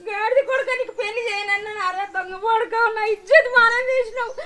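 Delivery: tearful mother scolding her son; weeping, scolding through tears; crying scold. Acted. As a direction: sob through the insults.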